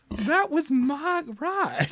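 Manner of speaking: high, soft, drawn-out character voice; small, slow, high-pitched voice; Hope. Acted. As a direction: say it slowly, high and quiet. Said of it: "Hope looks to you, uh, and he says".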